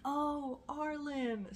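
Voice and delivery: high-pitched mock "oh, I see" realisation; Canadians finally getting it. Imagined Canadians catching the name at last, high mock realisation. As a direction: imitate sudden understanding, pitched well up. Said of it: "And they'd be like".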